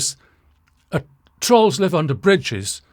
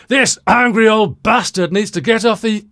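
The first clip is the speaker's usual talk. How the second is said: louder, higher mock-outraged commenter; angry commenter voice; 6 semitones higher, 7 dB louder than usual. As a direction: put on a loud, indignant complainer's voice. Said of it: "a suitable voice to speak with".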